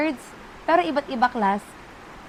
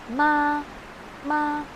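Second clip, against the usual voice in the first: "Ma" sustained high and horizontal; flat first tone; 3 semitones higher than usual. Shown as a demonstration. Teacher showing learners the horizontal tone, long high level "Ma". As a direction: hold "Ma" level, no rise or fall. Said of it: "Horizontal lang siya".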